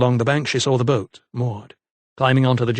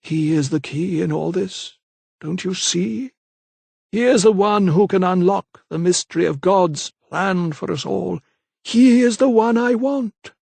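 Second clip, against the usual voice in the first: softer vicar; 7 semitones higher than usual. A narrator's reading. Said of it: "his voice was softer when he spoke".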